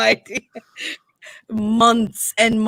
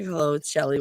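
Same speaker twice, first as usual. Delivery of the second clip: low, soft phone hello; phone greeting voice; 9 semitones lower, 7 dB quieter, faster than usual. Retold or imitated. Answering a call at work, smooth phone voice.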